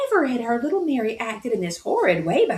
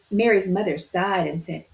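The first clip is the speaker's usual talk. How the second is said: usual speaking voice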